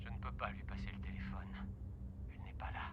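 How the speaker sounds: whispering. Acted. Father breaks bad news.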